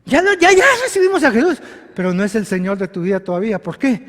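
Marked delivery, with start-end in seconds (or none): 0.1-1.6 mock claim. Mocking believers' claim.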